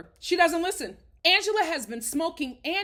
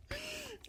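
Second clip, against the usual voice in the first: high breathy laugh; 12 dB quieter than usual. Real, not acted. Embarrassed about video quality.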